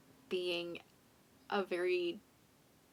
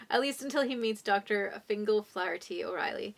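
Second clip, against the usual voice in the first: ordinary talk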